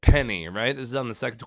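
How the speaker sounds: ordinary delivery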